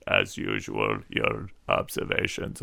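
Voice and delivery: lightly voiced toady buttering up the boss; fawning aide. Sycophantic aide voice mocking.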